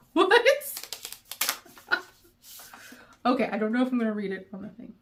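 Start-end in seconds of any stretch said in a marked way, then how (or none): 0.4-1.6 high squealing giggle; giddy laugh. Real, not acted. Surprised by a letter from the author, giddy high giggle. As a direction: let out a delighted squeal-laugh.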